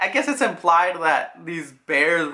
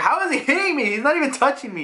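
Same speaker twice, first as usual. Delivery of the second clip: Luffy; 9 semitones higher, faster than usual. Retold or imitated. Luffy baffled while being slapped.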